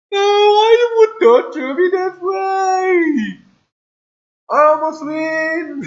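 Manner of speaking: high, loud, crying-out protest; anguished wail. Real, not acted. Player to the crashed game after almost winning, anguished high wail. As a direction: wail loudly at being robbed of a win.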